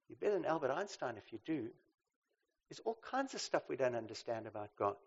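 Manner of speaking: normal talking voice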